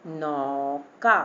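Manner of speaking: नौका very slow, with a long औ; taking time. Shown as a demonstration. Teacher shows the long vowel, नौका drawn out. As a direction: stretch both syllables of नौका. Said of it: "इसको बोलने में time लगेगा".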